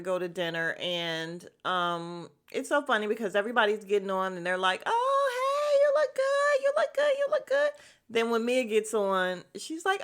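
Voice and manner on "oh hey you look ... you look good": very high, squealed mock compliments; gushing greeting. Friends getting on the bus to dinner, squealed gushing greetings. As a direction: gush it like excited friends, far up high.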